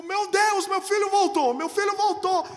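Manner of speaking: high, loud joyful cry; father shouting when his son comes back; overjoyed. Retold or imitated. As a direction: shout it with relief. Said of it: "eu fiquei tão feliz".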